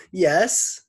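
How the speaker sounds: high, pleasant Scottish lilt; Scottish gentleman. A narrator's reading. Teacher answers in stolen voice, high Scottish gentleman. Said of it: "the pleasant voice he took from the Scottish gentleman".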